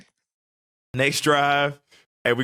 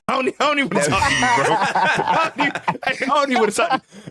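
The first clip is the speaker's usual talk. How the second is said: laughing; 10 semitones higher, faster than usual. Real, not acted. Laughing retelling of being mad.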